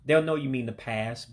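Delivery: normal talking voice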